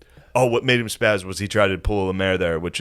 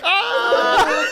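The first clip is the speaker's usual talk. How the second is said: mock high-pitched cackling yell; retold yell; 3 dB louder than usual. Comic face to face with a beaten friend, high mock yell. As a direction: yelp high as if mocking someone to his face.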